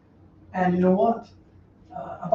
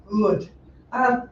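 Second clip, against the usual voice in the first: normal talking voice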